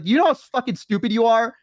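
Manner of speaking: high-pitched acted exasperation; upset scolding. Elf berating her injured friend, acting exasperated. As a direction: imitate a worried friend berating someone.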